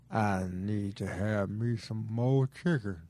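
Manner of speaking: slow, low fat-guy voice. Acted. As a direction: drag it out low as a big eater. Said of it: "I don't know if I can do a fat guy voice".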